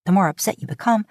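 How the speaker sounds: ordinary delivery, nothing marked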